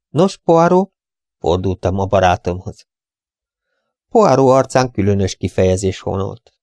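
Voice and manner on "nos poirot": eager question. Acted. Narrator calls to his friend.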